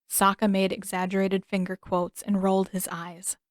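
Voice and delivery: ordinary delivery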